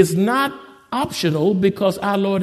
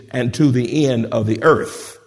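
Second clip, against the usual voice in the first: ordinary talk, nothing marked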